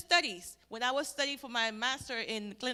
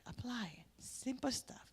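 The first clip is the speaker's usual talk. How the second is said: soft whisper; 11 dB quieter than usual. Real, not acted. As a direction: lean in and whisper it. Intimate advice.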